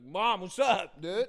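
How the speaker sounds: put-on loud child calling through the door; kid voice. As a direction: yell it like a kid at the bathroom door. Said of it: "knocking at the door like".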